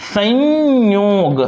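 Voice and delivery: संयोग drawn out very slowly, sound by sound; teacher sounding out संयोग; slow spelled-out demo. Shown as a demonstration. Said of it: "फिर उसी प्रकार ये हो जाएगा".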